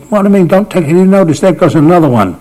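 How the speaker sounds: high, loud, quick mock outrage; exasperated retort. Bowler being hit about answers teammates, exasperated. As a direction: imitate a riled bowler, pitched right up.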